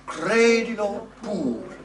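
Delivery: "credilo" stressed on its first syllable, slow and loud. Shown as a demonstration. Coach shows the accent far from the end, slow and loud. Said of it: "accent a long way from the end".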